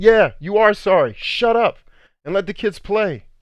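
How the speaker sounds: high, loud retold telling-off; angry scolding. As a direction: cut him down, re-enacting the anger.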